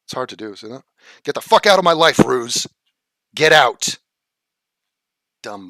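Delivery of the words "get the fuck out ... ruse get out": louder furious kick-out; throwing a chatter out of the stream; angry shout. Real, not acted. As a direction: bark him out of the room. Yelling at chatter to leave.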